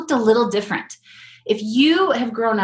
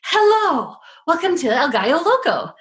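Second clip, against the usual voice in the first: high, sing-out greeting; effusively welcoming; 7 semitones higher than usual. Retold or imitated. Drive-thru guy greeting new customers, effusively welcoming. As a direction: greet them with a big, lifted hello. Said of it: "like, welcoming".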